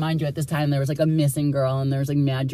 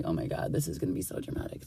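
a secret thought whispered to yourself; hushed, unvoiced inner comment; whispered aside; 12 dB quieter, faster than usual. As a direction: whisper it under your breath. Private thought during arrest.

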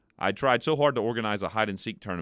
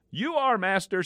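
quivering monkey; 11 semitones higher than usual. Acted.

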